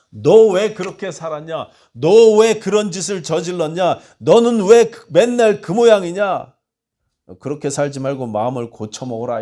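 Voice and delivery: loud, high exasperated rebuke; harsh scolding. Pretend. God imagined scolding a sinner, loud exasperated rebuke. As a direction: yell at them like a fed-up judge. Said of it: "이렇게 야단치시는 것이 아니고".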